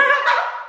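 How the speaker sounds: squealing laugh; high giggle. Real, not acted. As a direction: burst out giggling. Laughing at Paul.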